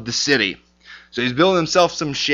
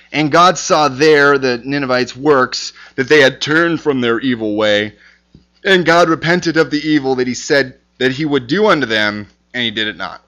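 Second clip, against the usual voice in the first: scripture reading. Acted. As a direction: read the verse out with weight. Reads scripture aloud.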